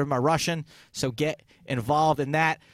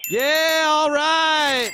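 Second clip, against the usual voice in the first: excited; 11 semitones higher, 6 dB louder, much slower than usual. Real, not acted. Host celebrating the winner.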